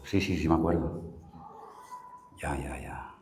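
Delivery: very low, hushed mock admission; sheepish admission. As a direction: murmur it as if caught out. Acted sheepish answer to his question. (admisión avergonzada, muy bajito)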